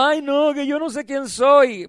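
whining protest, lightly put on; mock self-pity. Preacher imitating believer stuck in the past, mock self-pity. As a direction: mimic someone wailing an excuse.

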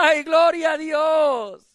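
high, drawn-out shout of praise; exultant praise. Real, not acted. Preacher thrilled at the coming cherries, exultant praise. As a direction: cry it out jubilantly, stretching the words.

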